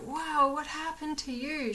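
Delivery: lightly voiced curious rabbit; mild rabbit excitement. Voicing the rabbit Lois sniffing her sick friend, mildly excited. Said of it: "She was so excited".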